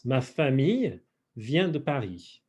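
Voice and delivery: measured French model, split after famille; phrase in pieces. Shown as a demonstration. Teacher translating for the class, phrase in pieces. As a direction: pause between "Ma famille" and "vient de Paris".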